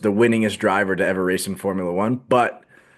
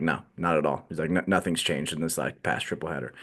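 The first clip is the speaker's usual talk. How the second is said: imitating a gloomy driver, much softer and lower; mock dejected mumble; 7 semitones lower, 9 dB quieter than usual. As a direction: sink into a flat, deflated reply. Lewis answering reporter, dejected.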